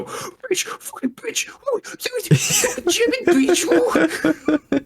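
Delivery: very high wailing British mock rant; mock British crying rage. Co-host imitating the streamer's British friend crying. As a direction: shriek it like a sobbing British friend. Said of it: "British friends go".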